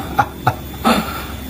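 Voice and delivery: mocking laugh. Real, not acted. Mocking the alleged recording.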